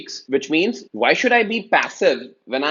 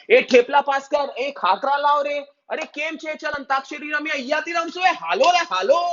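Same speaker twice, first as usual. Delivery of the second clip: higher, fast, rowdy calls across the plane; rowdy Gujarati tourists; 11 semitones higher, much faster than usual. Retold or imitated. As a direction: shout across rows like a noisy tour group. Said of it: "Gujaratis on the plane".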